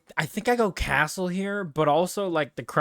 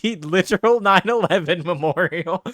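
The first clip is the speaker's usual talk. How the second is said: laughing; 5 semitones higher, 5 dB louder than usual. Real, not acted. Cracking up at memorial picture.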